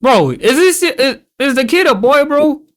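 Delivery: high, loud disbelief; incredulous, voice jumping up; shocked disbelief. Real, not acted. Confused about character gender.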